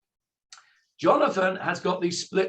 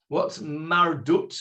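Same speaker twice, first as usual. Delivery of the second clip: ordinary talk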